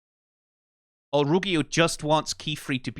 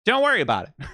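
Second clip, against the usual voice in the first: higher, louder mock wave-off; sarcastic reassurance; 6 semitones higher, 3 dB louder, faster than usual. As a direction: brush it off with an exaggerated wink.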